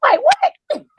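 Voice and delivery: high, loud incredulous what; surprised. Real, not acted. To the group, shocked disbelief at the remark. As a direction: squeak out a shocked question.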